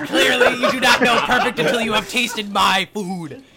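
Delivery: loud, high laughing boast; laughing chef. Acted. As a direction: gloat through a laugh.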